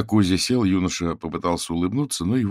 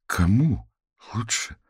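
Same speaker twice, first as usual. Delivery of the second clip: higher, halting puzzled question; bewildered; 6 semitones higher, slower than usual. A narrator's reading. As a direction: ask it at a loss, word by word. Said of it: "растерянно проговорил Олег".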